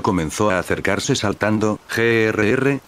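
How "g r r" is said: plain reading voice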